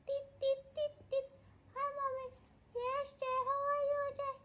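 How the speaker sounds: very high squeaky puppy voice; voicing the pet. The dog greeting its mommy, tiny squeaky voice.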